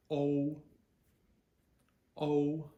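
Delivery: O as the letter's name, high and level; long O. Shown as a demonstration. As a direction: hold a long O on one high note. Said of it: "think a long O".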